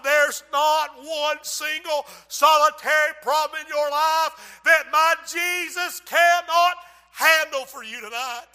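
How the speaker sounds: loud, high, driving altar call; full-force preaching. Acted. Preacher urging congregation, loud rising preaching. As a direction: build it up and hammer it home.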